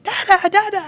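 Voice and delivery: put-on very high, loud squealing toddler; excited toddler voice. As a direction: squeal it like a thrilled baby. Daughter greeting dad from high chair.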